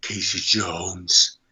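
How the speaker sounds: low, slow tough-guy growl; gruff Clint Eastwood. Retold or imitated. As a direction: say the name like a young Clint Eastwood. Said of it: "just do a young Clint Eastwood".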